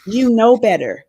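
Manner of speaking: loud, high reproach that falls away; sharp scolding. A narrator's reading. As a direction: scold sharply, stop the child in her tracks. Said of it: "with a sharpness in her tone".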